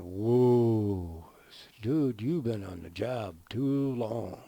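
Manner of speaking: long loud whoa, then slow words; taken aback. Retold or imitated. Foreman to worker who thinks he's a bulb, taken aback. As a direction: stretch it out in mock disbelief. Acted foreman reacting to absurd claim.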